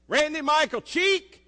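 high, loud full-name holler; mother yelling. Retold or imitated. Mother calling her son from the living room, yelling. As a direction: call him from across the house.

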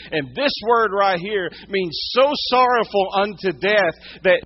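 high, loud, impassioned sermon; pressing the point home from the pulpit; full-force preaching. Real, not acted.

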